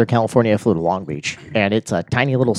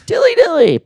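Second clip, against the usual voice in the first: mock crowd echo. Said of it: "and he's like".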